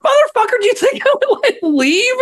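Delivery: high-pitched indignant retort; mock outrage. Parent answering an accusing stranger, mock outrage. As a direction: retell it as an incredulous, exasperated comeback. Retold angry retort to bystander.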